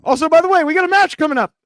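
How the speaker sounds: rushed then shouted announcer bit; ring announcer. Pretend. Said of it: "Ring announcer?".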